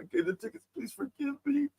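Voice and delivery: high, soft apologetic plea; pleading apology. Retold or imitated. Friend confessing she gave the tickets away, pleading. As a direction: beg forgiveness in a small, high voice.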